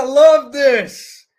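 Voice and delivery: loud, drawn-out burst of delight; excited exclamation. Real, not acted. Coach cheering on the group, excited. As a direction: raise it high and stretch it out.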